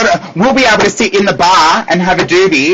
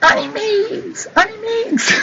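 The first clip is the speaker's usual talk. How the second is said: high, slow, soft crooning Honey Meeks; Nashi Pear voice; 6 dB quieter than usual. Retold or imitated. Imitating Nashi Pear calling her Honey Meeks, high and soft. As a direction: coo it way up high and slow, mocking a friend. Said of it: "all I can hear in my head is Nashi Pear going".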